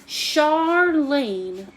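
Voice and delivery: drawn-out Southern Charlene; deep south accent. Pretend. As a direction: say the name with a deep south drawl. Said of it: "love saying your name like I'm in the deep south".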